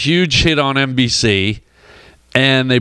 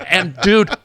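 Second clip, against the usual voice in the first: usual speaking voice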